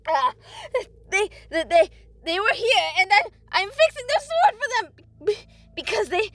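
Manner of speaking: high, loud, tearful stammering; scrambling for words. A narrator's reading. Tortured blacksmith explaining to the creature, panicked. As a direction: blurt it out in panic and pain. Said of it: "He scrambled for words".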